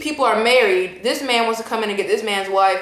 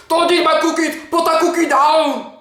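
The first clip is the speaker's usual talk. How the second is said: Arnold Schwarzenegger impression; 5 semitones higher than usual. Arnold telling the cookie-eating neighbor to stop, shouted Austrian accent. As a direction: yell it like Arnold guarding his cookies. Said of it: "That's my Arnold Schwarzenegger impersonation".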